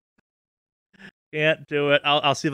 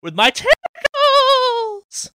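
rising into a high, loud drawn-out screech; vengeful monster; 6 dB louder, much slower than usual. Pretend. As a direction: shriek the threat like a hammed-up monster. Monster threatens people who shot it.

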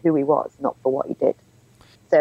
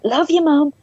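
much higher playful call; teasing singsong; 11 semitones higher than usual. Retold or imitated. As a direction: sing it out to tease mum. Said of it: "trying to embarrass her".

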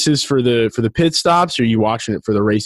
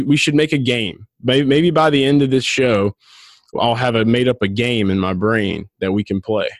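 normal talking voice

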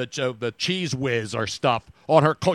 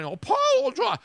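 put-on Boston accent. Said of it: "I can't even do the apologized Boston accent".

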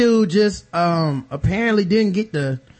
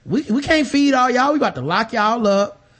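higher, pressing argument; heated argument; 7 semitones higher than usual. Retold or imitated. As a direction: press the point hard. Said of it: "He said".

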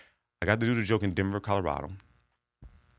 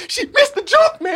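much higher and louder, laughing disappointment; laughing lament; 11 dB louder, slower than usual. Retold or imitated. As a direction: mimic the fan's laughing frustration. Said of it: "He said".

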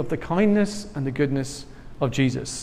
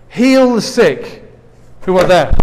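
raised, deliberate Bible-reading voice; scripture reading; 2 semitones higher, 6 dB louder than usual. Acted. Reads scripture aloud.

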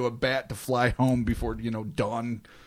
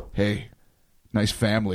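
menacing agent; 5 semitones lower than usual. Retold or imitated. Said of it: "men from Arizona come knocking".

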